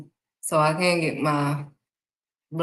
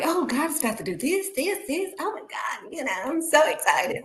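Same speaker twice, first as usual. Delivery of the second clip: very high, gushing retelling; excited; 10 semitones higher than usual. Retold or imitated. Believer telling everybody her news, excited. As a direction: gush the news in a high, giddy voice. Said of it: "I was excited, telling everybody".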